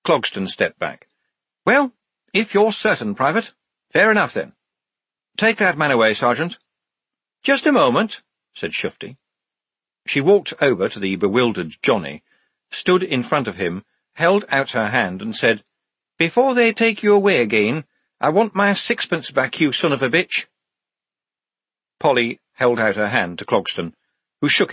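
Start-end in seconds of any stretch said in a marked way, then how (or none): 1.6-6.6 concede, then give the order. A narrator's reading. Officer accepting Shufti's decision and dismissing Johnny, high-pitched. 7.4-8.2 high-pitched, emphatic interjection. A narrator's reading. Shufti halting the sergeant taking Johnny away, high and emphatic. 16.2-20.5 high-pitched demand, easing down. A narrator's reading. Shufti wanting sixpence back from Johnny, high and insistent.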